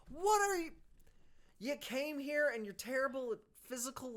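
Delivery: loud, high-pitched exasperated yell; incredulous outburst. Real, not acted. Reader scolding the foolish hero, incredulous outburst. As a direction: shout it in utter disbelief.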